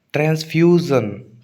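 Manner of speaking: slow transfusion with a strong few; stress on few. Shown as a demonstration. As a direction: lean on FYOO in transfusion. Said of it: "few पर stress आएगा". (Transfusion के few पर ज़ोर)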